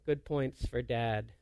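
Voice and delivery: low, soft, sheepish aside; dry self-reproach, voice dropped right down; deflated self-mockery. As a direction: sink into a quiet, rueful mumble. Deflated dad mocking himself after outburst.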